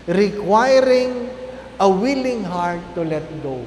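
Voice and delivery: slow, measured English reading; formal reading. Acted. Priest reads a quote to the congregation, slow and formal. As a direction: read the quote deliberately, word by word.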